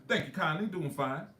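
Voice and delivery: a hint of a genteel old Southerner; old Southern man. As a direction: do old Johnson's courtly Southern greeting. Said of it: "nobody talks like that".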